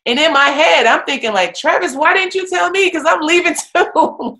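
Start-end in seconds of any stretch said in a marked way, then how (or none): 1.5-4.4 high, loud mock panic; laughing disbelief at the coach leaving; exasperated inner cry. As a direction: throw up your hands inside your head.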